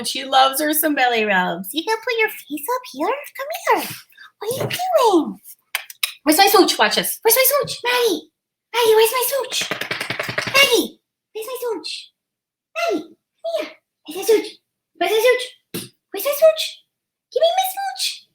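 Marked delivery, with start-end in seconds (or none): 1.7-6.8 coaxing the dog. Real, not acted. 7.2-18.3 high-pitched begging for a kiss; coaxing the dog. Real, not acted.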